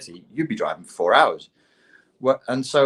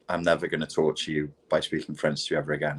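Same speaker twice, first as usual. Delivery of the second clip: muttered apology; 6 semitones lower, 6 dB quieter, faster than usual. Retold or imitated. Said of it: "and I was like".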